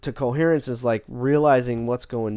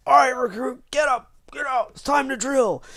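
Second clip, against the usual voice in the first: much higher, barked drill instructor voice; drill sergeant wake-up; 13 semitones higher than usual. Retold or imitated. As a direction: bark it like a mock drill sergeant rousing a recruit. Titus wakes her with whistle.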